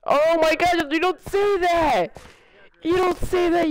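high, slow shouted scold; joking outrage at a friend; mock scolding shout. Real, not acted. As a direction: yell it in fake outrage. Mock scolding over a joke.

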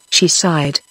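ordinary delivery

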